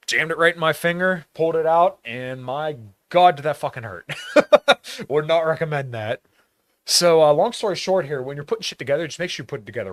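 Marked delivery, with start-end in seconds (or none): 4.1-5.0 a high, loud laugh breaking out; high rueful laugh; burst of laughter. Real, not acted. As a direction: laugh at your own pain. Laughs at own injury story.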